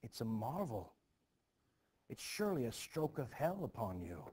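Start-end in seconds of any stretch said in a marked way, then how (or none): none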